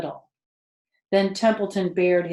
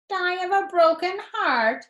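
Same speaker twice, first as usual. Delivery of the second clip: much higher, sneering imitation of Wilbur; mocking mimicry; 12 semitones higher, 5 dB louder than usual. Templeton the rat mocking Wilbur's plea, sneering mimicry. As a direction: parrot him back with a sneer. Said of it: "he mimicked".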